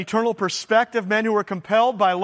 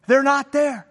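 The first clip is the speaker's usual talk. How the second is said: higher, incredulous repeat; mock disbelief; 4 semitones higher than usual. Officer reporting the apostles missing, mock disbelief. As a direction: repeat it in raised-pitch astonishment.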